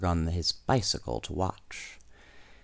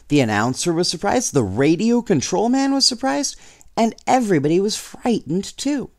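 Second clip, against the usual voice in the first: building excitement; 7 semitones higher than usual. A narrator's reading. Building astonishment in narration.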